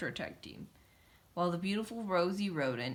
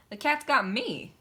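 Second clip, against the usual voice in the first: panicked cry; 5 semitones higher, 7 dB louder than usual. A narrator's reading. Rosie seized.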